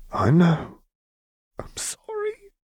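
very high, squeaky crying voice; tearful sniffling. A narrator's reading. Vi apologising as she cries on Caitlin, tearful. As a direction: apologise through tears, voice thinning to nothing. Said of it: "Vi sniffled".

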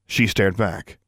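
ordinary delivery

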